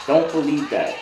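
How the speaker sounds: ordinary talk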